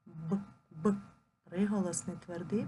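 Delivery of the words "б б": short, separate "Б, б" as bare consonant sounds; "Б, б" as two crisp single sounds, not letter names; isolated sound. Shown as a demonstration. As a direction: say the hard sound "Б" alone, twice, with a gap. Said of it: "вимовляємо кожен звук окремо".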